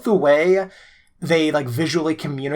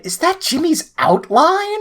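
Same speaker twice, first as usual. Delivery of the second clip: much higher, slow squeaky quote; mock high quote; 12 semitones higher, slower than usual. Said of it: "you immediately said".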